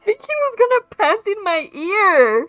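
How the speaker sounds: high, loud, laughing disbelief; flustered shock. Real, not acted. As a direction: burst out, scandalized and giggling. Startled by voice panting in ear.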